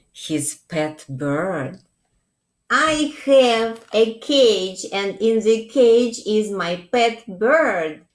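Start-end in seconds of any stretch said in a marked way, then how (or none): 2.7-8.1 a lightly voiced proud young mole, a touch louder; proud little Mole. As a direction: show off the pet bird proudly. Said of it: "said Mole. He was so proud".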